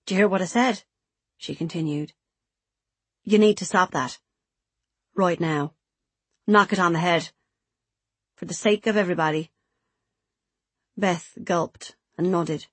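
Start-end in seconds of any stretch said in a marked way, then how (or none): 0.1-9.5 lightly voiced scolder, pressing the point; insistent Kaylee. Kaylee continuing to lecture Beth on smoking, insistent. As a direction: press it home in separate firm phrases.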